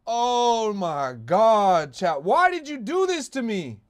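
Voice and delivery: slow, high anguished wail; crying with rage. Real, not acted. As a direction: wail it out in despair. Wailing at the memes.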